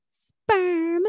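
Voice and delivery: high, eager "Sperm"; excited. Retold or imitated. Monica telling Joey what she's banking, mock excited and high. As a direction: chirp it like thrilled Monica. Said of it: "And she all excited".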